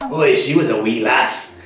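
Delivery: put-on Scottish accent. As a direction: play a Scot chatting, lightly Scottish. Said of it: "if you meet a Scottish person, they'll go".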